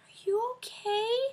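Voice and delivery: put-on high, slow child's voice; little girl. Twelve-year-old asking the crying vlogger, high and small. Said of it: "girl next to me patted my shoulder".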